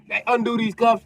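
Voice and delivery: high, loud impatient demand. Acted. Sniper ordering police to undo his cuffs, loud.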